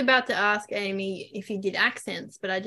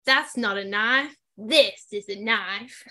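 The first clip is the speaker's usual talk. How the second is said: slow, drawn-out Australian movie quote; Australian accent; slower than usual. Shown as a demonstration. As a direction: deliver a slow Australian film line. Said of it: "it's from Crocodile Dundee".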